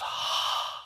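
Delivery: long voiceless exhale; imitated "haah" of breath; mimed breath out. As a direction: breathe out open-mouthed for a breath check. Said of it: "And she goes".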